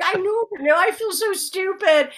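high, whiny mock-crying; acted-out weepy protest; tearful. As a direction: wail it like an embarrassed child. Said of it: "Are you crying?".

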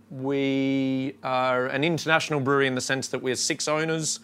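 usual speaking voice

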